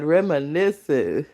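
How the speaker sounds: usual speaking voice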